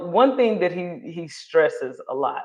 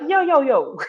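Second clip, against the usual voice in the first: higher-pitched, interrupting "hold on"; mock protest; 9 semitones higher, 4 dB louder than usual. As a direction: cut in with an imitated objection. Acted objection to elders' excuses.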